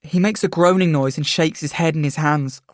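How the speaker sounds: ordinary delivery, nothing marked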